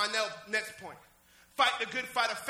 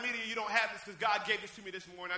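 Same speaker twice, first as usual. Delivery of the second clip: ordinary talk, nothing marked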